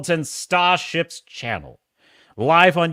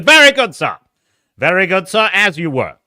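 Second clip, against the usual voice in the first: loud, raised officer's reply; captain's acknowledgement; 3 semitones higher, 8 dB louder than usual. Pretend. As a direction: acknowledge the crew like a commanding officer. Answers chat as ship's captain.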